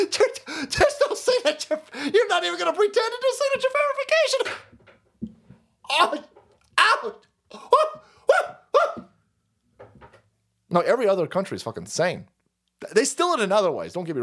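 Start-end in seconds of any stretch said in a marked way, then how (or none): 0.0-9.0 high, laughing disbelief; mock foreign leaders laughing. Foreign leaders marvel at US voting, laughing high. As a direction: cackle in shocked disbelief at America. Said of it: "They go".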